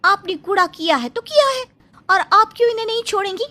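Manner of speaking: ऊँची आवाज़ में तुनकती जवान बहू; सास का पक्ष लेकर पड़ोसन को ऊँची आवाज़ में ललकारो; बहू प्रिया की आवाज़. Acted. (पड़ोसन से भिड़ती बहू, ऊँची तीखी आवाज़ में)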